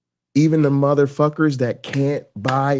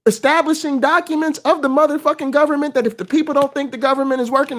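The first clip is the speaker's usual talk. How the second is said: ordinary delivery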